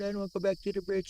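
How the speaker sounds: mock scared friend. Teasing the old friend about being scared, mock frightened whine. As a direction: parody his friend's fear. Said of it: "You were so scared".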